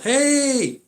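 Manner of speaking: high-pitched, drawn-out holler; imitated yell. Guy yells to the woman he was to meet, high imitated holler. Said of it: "the guy that she was supposed to meet yells".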